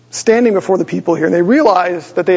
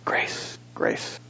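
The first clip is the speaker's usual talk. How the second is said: soft unvoiced whisper of the word; whispered reply; whispered answer; 15 dB quieter than usual. Real, not acted. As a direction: breathe the answer almost silently.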